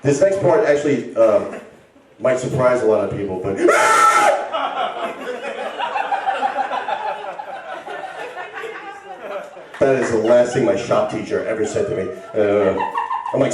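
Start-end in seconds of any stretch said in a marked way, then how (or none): 3.6-9.4 maniacal laugh. Acted. Maniacal laugh before punchline.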